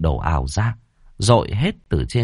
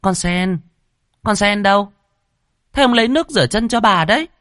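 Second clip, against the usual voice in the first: much higher, piercing mistress voice; shrill mistress; 14 semitones higher than usual. A narrator's reading. Second wife summoning her maid, shrill and high. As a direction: screech it like a spoiled lady of the house. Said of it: "nói bằng cái giọng choe choé".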